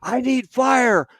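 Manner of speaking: high, loud, slow shout for fire; lieutenant calling in fire under attack, urgent shout; urgent radio call. Retold or imitated. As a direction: bark the fire mission over the radio. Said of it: "He goes".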